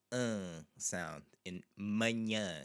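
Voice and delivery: "manhã" with a slow nasal ã; nasal a demo. Shown as a demonstration. Teacher demonstrating the nasal vowel, drawn out. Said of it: "It's this nasally A sound".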